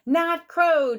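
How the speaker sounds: put-on a kid yelling with joy at birds; excited shout. A narrator's reading. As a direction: shout it out to the sky. Child spotting birds, shouting.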